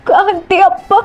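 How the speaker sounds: high-pitched distressed call; alarmed cry. Acted. Daughter cries out in the fight. (பதற்றமான உயர்ந்த குரல்)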